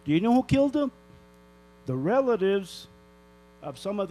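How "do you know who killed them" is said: higher, louder demanding question; angry. Real, not acted. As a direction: push the question at them. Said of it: "I'm really pissed off".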